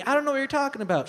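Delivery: high, fast denial; defensive sister. Acted. Sister answers mom on the phone, high quick denial. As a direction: rattle off a high-pitched denial. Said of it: "My mom calls my sister".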